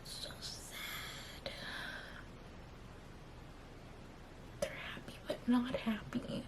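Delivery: a hushed, crying whisper; tearful whisper. Real, not acted. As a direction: whisper through the tears at one in the morning.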